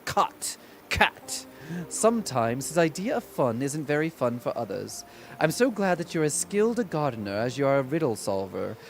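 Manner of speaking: a faint put-on British accent, lightly held; reading the Hatter's lines in a half-kept mock British accent; fake British accent. Streamer reading character lines in bad British accent. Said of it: "It's hard to keep the faux, fake, bad British accent".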